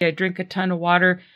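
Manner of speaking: ordinary talk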